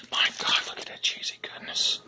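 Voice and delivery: hushed, awed whisper. Real, not acted. Vlogger admiring the cheesy biscuit, whispering. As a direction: breathe it out in awe.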